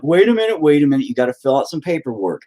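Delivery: hospital staff. Retold or imitated. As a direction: imitate staff interrupting, starting high and easing down. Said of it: "they're like".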